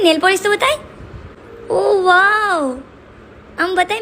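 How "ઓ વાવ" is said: long, drawn-out, higher cooing wow; admiring wow. Real, not acted. Parent distracting child over nail polish, admiring wow. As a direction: stretch it out in admiration.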